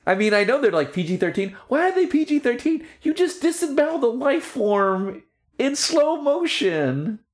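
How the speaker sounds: excited rant. Real, not acted. Ranting about gory cartoon.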